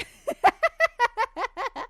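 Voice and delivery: big embarrassed laugh; loud laugh. Real, not acted. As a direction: burst of high, loud laughter. Laughs at own denial.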